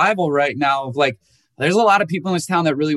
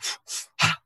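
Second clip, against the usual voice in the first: long audible exhale; a demo breath from meditation; deep breath out; 7 dB quieter than usual. Shown as a demonstration. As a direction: breathe out slowly and fully. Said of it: "you sit there and you go".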